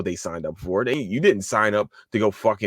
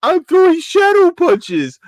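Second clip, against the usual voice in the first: high, loud slow mock of a posing celebrity; mocking celebrity tough guy; 9 dB louder, slower than usual. Vlogger mocking UFC celebrities posing, high hammed-up voice. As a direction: ham up a fake tough pose for the camera. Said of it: "do the whole".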